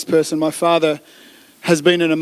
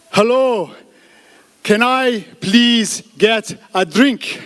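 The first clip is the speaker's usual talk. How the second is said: word by word, higher pitched, very slow; really slow speech; 4 semitones higher, much slower than usual. Acted. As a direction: draw out each word as if to a foreigner. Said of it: "just speak really slowly".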